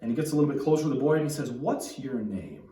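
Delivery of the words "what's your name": slow, measured, firm question. Retold or imitated. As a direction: ask it slowly, with acted authority. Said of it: "A little firm, more firm about it".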